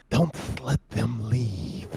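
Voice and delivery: slow, drawn-out sinister order; Inquisitor's measured command. Acted. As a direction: give the order slowly, in character. Inquisitor gives an order in character.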